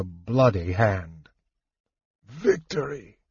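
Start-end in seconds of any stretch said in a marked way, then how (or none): 2.2-3.2 a soft, strained croak of a dying man; faint croak, half breath. Acted. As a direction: force the word out through pain. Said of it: "he croaked".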